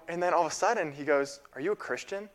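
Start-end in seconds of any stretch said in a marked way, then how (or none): 1.5-2.3 higher, softer retold question with condescension; condescending mock question; demeaning, condescending. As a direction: imitate someone talking down to you. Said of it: "in this demeaning, condescending tone".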